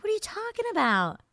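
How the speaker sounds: very high, squeaky-pitched protest; mock disbelief. Guest disputing host's limited range, high mock disbelief. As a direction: shoot the voice way up in disbelief.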